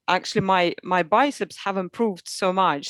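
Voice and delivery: normal talking voice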